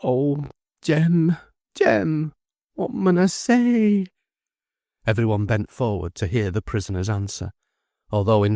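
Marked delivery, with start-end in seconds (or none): 0.0-4.0 slow, high anguished plea; moaning.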